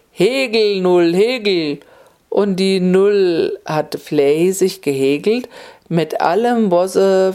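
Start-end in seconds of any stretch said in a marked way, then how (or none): 0.1-1.8 magic spell. A narrator's reading. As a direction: chant the words to set the needle going. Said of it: "sagt er". (Zauberspruch, höher gerufen)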